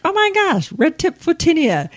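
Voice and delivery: high, surprised exclamation; mock astonished discovery. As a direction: gasp out the plant name in disbelief. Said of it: "I'm like".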